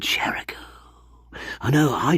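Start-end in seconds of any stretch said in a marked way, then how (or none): 0.0-0.6 the name Cheriku whispered, unvoiced; whispered instead of yelled. Comically whispering the name he claims he yelled. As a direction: breathe the name Cheriku, no voice at all. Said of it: "And I yelled".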